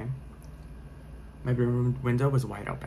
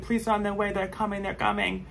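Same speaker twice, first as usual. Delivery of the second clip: frightened high cry; retold panicked scream; 9 semitones higher than usual. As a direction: tell him help is coming, frantic. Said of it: "I'm screaming".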